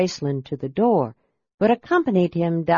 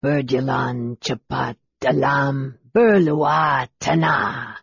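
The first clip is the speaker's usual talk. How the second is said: low, slow spellcasting; deliberate, lowered wizard's chant; magic incantation; 4 semitones lower, slower than usual. A narrator's reading. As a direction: intone it like words of power. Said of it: "spoke the words of magic".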